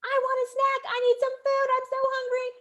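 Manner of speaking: put-on high, loud demanding child's whine; whiny screaming child. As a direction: scream it like a hungry toddler. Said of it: "instead of just screaming and crying".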